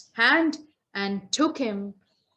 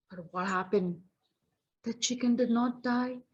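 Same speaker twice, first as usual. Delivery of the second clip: plain reading voice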